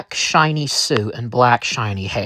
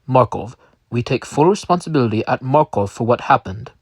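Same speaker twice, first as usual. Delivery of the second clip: ordinary delivery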